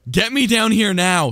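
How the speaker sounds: high, urgent plea; panicked plea. Real, not acted. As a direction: beg to get down fast. Panicked, hiding under the desk.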